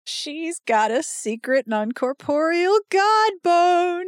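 high, slow, drawn-out bawdy announcement; delighted high proclamation; gleeful crowing. Real, not acted. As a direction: crow it like scandalous good news.

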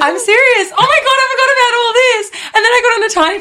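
high, loud laughing insistence; laughing protest. Real, not acted. Accused of making it up, she laughs loudly. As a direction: insist while cracking up.

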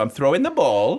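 mock Welsh accent drifting towards Aberdeen; wayward Welsh accent. Narrating his rugby move in role, wayward Welsh accent. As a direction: attempt Welsh and land near Aberdeen. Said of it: "Aberdeen with your accent".

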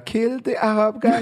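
high, mock German-accented order; quick hammed-up German accent in English. Comedian in Berlin voicing Hitler in English, German accent. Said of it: "j'ai fait vite fait l'accent comme ça".

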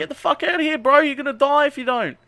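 very high-pitched, hammed-up urgency; mock urgent warning. [name] warning his friend to flee, mock urgent. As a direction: imitate a frantic warning, voice pushed way up.

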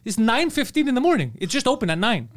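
normal talking voice

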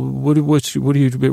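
normal talking voice

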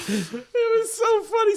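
high laughing, near crying; laughing hard. Real, not acted. Comedian laughing hard at the memory. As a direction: crack up while saying it.

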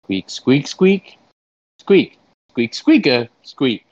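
mock squirrel language in short squeaks; squirrel talk. Interpreter asks a squirrel about worldwide events, squirrel talk. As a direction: squeak out a question like speech.

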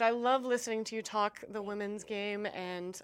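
normal talking voice

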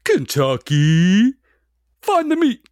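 high, drawn-out football play call; rising, stretched comic yell; Bill Murray impression. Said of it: "He yells out".

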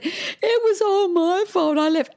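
high, drawn-out mock wail; retold distraught confession. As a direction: wail the guilt, hammed up. Retold distraught confession to other mum.

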